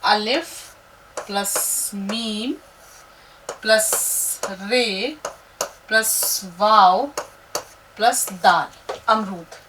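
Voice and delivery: very slow, drawn-out letter listing; teacher adding letters to build a word; slow letter count. Shown as a demonstration. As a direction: count each letter off slowly. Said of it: "देखते हैं कि कितने हर्फ".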